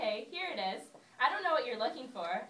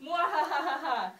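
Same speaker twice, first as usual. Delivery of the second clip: slow theatrical villain cackle; slow evil mwahaha; evil laugh; 4 semitones higher, 4 dB louder than usual. Acted.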